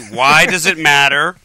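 WHY DOES IT MATTER slow and loud, each word separate; slowly. Real, not acted. Host pressing his rambling co-host for an answer, slowly. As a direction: hammer out the question word by word. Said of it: "let's say it slowly".

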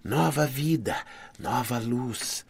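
soft otherworldly chant, slowing to the end; softly intone, fading away; voices of lights. A narrator's reading. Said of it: "as luzes diziam". (voz das luzes, suave)

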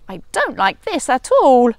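a touch of squeaky mouse complaining; squeaky complaint. Acted. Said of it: "squeaked Town Mouse".

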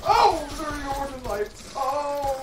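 high, loud yelps of fright; panicked yelps. Real, not acted. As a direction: yelp in fright. Panicked screams.

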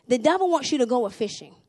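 normal talking voice

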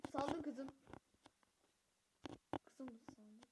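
normal talking voice

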